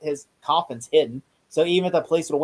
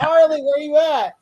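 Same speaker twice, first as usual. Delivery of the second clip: higher, louder mom calling for her son; 8 semitones higher, 5 dB louder than usual. Retold or imitated. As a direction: shout across the house for Charlie.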